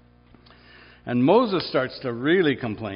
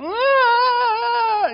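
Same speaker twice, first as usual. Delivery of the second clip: very high, loud mock baby cry; shrill imitated infant scream; imitated baby wail; 11 dB louder than usual. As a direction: wail like a hungry newborn in a nursery. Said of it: "all the little babies are going".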